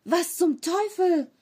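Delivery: high, slow startled cry; erschrockener Ausruf. A narrator's reading. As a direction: cry out in sudden fright. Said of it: "Erschrocken rief er". (erschrocken und hoch aufschreiend)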